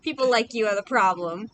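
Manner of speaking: loud retort. Real, not acted. Arguing back at a teasing friend, loud. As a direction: throw it back loudly, stretching the words. Mock quarrel with laughing friend.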